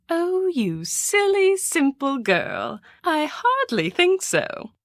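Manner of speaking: condescending. Acted. Condescending to the girl.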